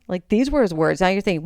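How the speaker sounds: ordinary talk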